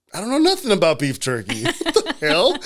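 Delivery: amused incredulity; laughing. Real, not acted. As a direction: laugh through the disbelief. Laughing at his own doubt.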